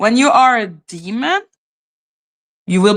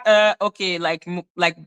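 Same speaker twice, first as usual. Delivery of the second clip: ordinary talk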